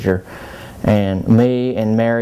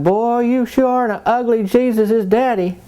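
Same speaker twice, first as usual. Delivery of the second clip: put-on much higher taunting kid voice; mocking little brother; 13 semitones higher than usual. Brother on a bike taunting Joseph, high and mocking. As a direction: tease like a bratty brother. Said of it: "He's like".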